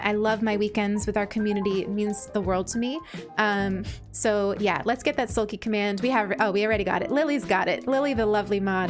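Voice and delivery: ordinary talk, nothing marked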